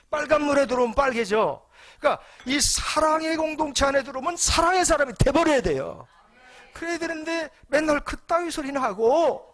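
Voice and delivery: loud, high, emphatic sermon delivery; rising fervent preacher's call; preaching at full force. Acted. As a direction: preach it out to the whole congregation. Preacher driving home his point.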